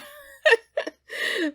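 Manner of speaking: high, loud squealing laugh. Real, not acted. Streamer laughing at the ghost's arrival, high squealing laugh. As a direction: burst into a high giggle.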